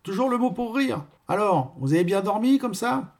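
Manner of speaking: high-pitched gossiping woman; chatty concierge. Acted. Concierge chatting to her tenant about the fog, high-pitched.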